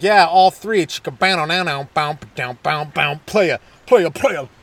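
mock swagger with voiced bassline; hammed-up smooth-playa chant; cocky player. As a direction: brag like a player, loud opening. Imagined boastful player reply.

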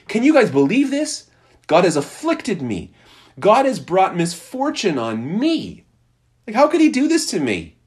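mock-whine to the crowd about God; complaining. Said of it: "you might assume that she's complaining".

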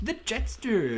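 high, drawn-out comic impression; Rob Schneider. Co-host copying the kid's impression from the film, high drawn-out voice. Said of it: "he does a Rob Schneider impression".

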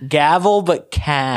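"Cav" rhymed with the start of "Gavel"; slow teaching of "Cav", like "Gavel" with a K; pronunciation demo. Shown as a demonstration. Co-host teaching the name, "Cav" sounded out like "Gavel".

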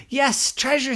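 high, slow, pleading little leprechaun; bedtime-story leprechaun voice; leprechaun boy voice. Acted. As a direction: squeak it up like a small hopeful leprechaun. Liam character voice.

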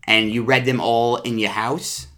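normal talking voice

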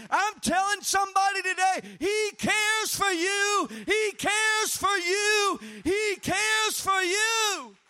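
high, loud, slow repeated cry; preaching climax. Acted. Preacher crying out to the congregation, full force. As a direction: hammer it home at full force.

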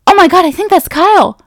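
high, loud gushing re-enactment; excited outburst. Retold or imitated. Telling Chris she spotted a friend, very excited. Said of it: "I just got so excited".

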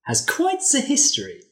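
hint at dark secrets; a touch of a sly villain; Helga, knowing. Acted. Helga tells of box.

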